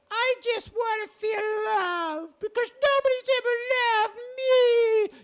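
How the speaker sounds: high, wailing mock-sob; whiny woman. Showing how he claims women talk, wailing. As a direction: bawl it in a long high whine. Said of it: "Fat women talk like this".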